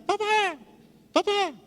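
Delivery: very high parrot cry; parrot calling. Retold or imitated. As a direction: screech like a parrot calling papa. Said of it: "Y gritan". (loro gritando muy agudo)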